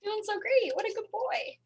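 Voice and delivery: high, soft praise for a dog; pet talk. Real, not acted.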